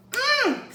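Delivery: high, swooping yummy hum; savoring "mmm". Real, not acted. Cook relishes dish over rice, savoring hum.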